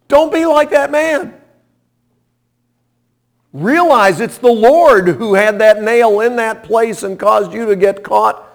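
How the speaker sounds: high, loud fervent preaching; preaching at full force. Real, not acted. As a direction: drive the point home to the congregation.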